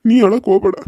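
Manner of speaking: ನೀ pushed out loud, a firm insistent don't cry. Acted. Father repeats, more forcefully.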